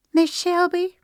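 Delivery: put-on a small girl running in with urgent news; little girl voice. Little Agnes calls Shelby urgently, high child's voice.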